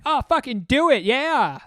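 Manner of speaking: high, loud egging-on whoop; rowdy teens. Retold or imitated. Teens heckling the comic, a high loud whoop. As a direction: egg the comic on like a rowdy teen.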